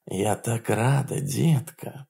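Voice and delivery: soft sultry purr. Acted. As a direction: purr it to him. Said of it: "промурлыкала она".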